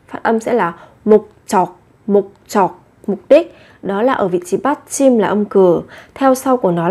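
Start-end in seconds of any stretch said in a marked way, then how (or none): 1.1-2.8 목적 as mok-jjeok, second consonant tensed; pronunciation demo. Shown as a demonstration. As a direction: say 목적 in two short syllables, mok-jjeok. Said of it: "Các bạn lưu ý về cách phát âm".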